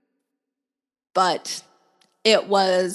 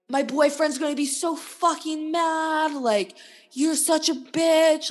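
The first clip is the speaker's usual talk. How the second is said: high-pitched mock rant; imitated angry yelling; 8 semitones higher than usual. Angry man yelling at her about the boyfriend, mock scolding. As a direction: act out his scolding, pitched way up. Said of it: "he was yelling at me".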